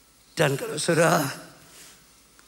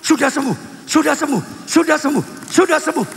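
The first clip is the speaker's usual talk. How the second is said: higher, insistent repeated proclamation; faith declaration; 7 semitones higher, 3 dB louder than usual. Retold or imitated. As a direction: declare it firmly, each phrase falling. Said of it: "saya mesti berkata". (pernyataan iman yang tegas dan berulang)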